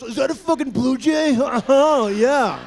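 high, loud gushing at a blue jay; thrilled birdwatcher. Retold or imitated. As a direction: gasp with delight at the bird. Birdwatcher spotting a bird.

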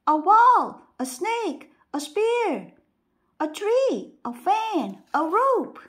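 loud, high angry shouting; angry voices. A narrator's reading. Six blind men fighting about the elephant, angry shouting. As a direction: hurl each word in a fight. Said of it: "The sound of angry voices".

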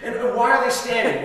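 put-on high, curious kid's voice; lightly voiced curious kid. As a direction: pipe up like a puzzled boy. Kid voiced asking in church.

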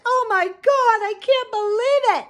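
high, slow mock gasp of a shocked snob; mocking shocked elite. Mocking the shocked elites in a high prissy gasp. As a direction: sneer at them with a falsetto gasp. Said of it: "all the elite are shocked".

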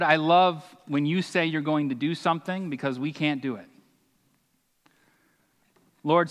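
ordinary delivery

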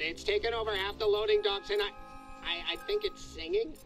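rapid-fire urgent phone message; hurried, alarmed caller. Acted. Shipper phoning about mold taking over the docks, hurried and alarmed. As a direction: rattle it off urgently, barely pausing.

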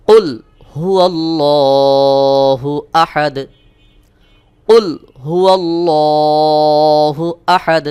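slow, melodic tilawat with Ahad short; correct recitation. Shown as a demonstration. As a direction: chant it evenly, no stretch on Ahad. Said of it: "সহি উচ্চারণ হলো". (সহি তিলাওয়াত, আহাদ টান ছাড়া)

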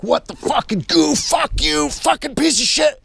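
high-pitched mock rage at the tire; retold angry outburst. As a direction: shriek curses in hammed-up fury. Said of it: "and fucking I'm like".

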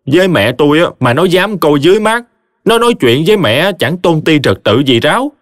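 indignant henpecked husband. Acted. Triệu venting to Khải about wife and mother, indignant. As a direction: list grievances to a sympathetic friend.

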